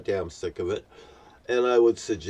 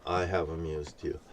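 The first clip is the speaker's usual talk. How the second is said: much lower and softer, almost under breath; hushed repeat; 7 semitones lower, 10 dB quieter than usual. Real, not acted. Speaking to God, echoing the line in a hush. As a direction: let it sink to a murmur.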